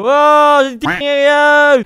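loud, high mock disbelief; drawn-out shouted reaction; imitated outburst. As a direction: imitate someone bursting in yelling. Acting out a famous clip.

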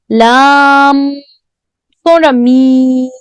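"Lam" sustained, then "mi" held slowly. Shown as a demonstration. Teacher demonstrating doubled mim, holding the sound. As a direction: hold "Lam", then draw out "mi".

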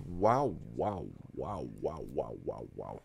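fading stunned wows. Real, not acted. Gamer trailing off stunned at the result. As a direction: let the wows die away stunned.

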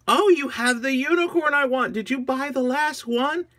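high, loud, amazed questioning; astonished little girl. Acted. Agnes seeing the minion's unicorn, high astonishment.

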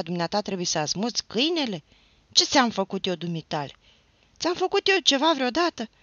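loud high furious tirade; a drunk, heartbroken lad raging at the neighbour; shouting without fear. A narrator's reading. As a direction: yell it all, losing all measure. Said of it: "să strige fără teamă".

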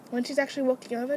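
normal talking voice

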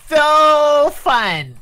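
very high, loud, drawn-out brag; mock boasting voice. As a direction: stretch it out in a silly put-on voice.

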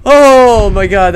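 slow loud relieved exclaim. Real, not acted. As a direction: breathe out in relief. Relief after fight.

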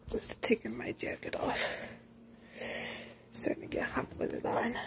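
soft, unvoiced whispered aside; hushed muttered whisper while fidgeting. Real, not acted. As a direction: whisper it off to the side. Quiet aside while taking jacket off.

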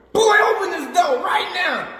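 mock angry mom yelling at her son. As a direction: yell through the car door like an angry mother. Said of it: "She said".

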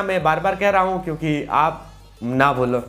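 ordinary delivery

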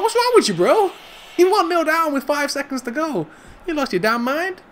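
high-pitched, incredulous referee telling-off; indignant referee. Acted. Referee to a player one nil down, indignant scolding. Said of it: "The referee's like".

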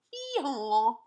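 loud imitated hee-haw; donkey bray. Donkey's bray in read-aloud story.

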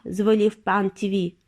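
ordinary delivery, nothing marked

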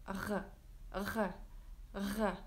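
R, r, r made soft at the back of the throat; French R. Shown as a demonstration. Teacher drilling the French R sound, soft and throaty. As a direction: repeat R, r, r with a quiet throaty French R. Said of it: "The French R, it goes here".

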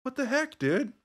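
high-pitched exasperated outburst; kinda freaking out. Retold or imitated. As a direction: protest in a high, baffled voice. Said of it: "kinda freaking out, like".

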